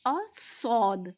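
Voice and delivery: सौद said slowly, full and heavy; heavy saud. Shown as a demonstration. Teacher models the heavy सौद slowly. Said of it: "ये मोटा करके, पुर करके पढ़ा जाता है".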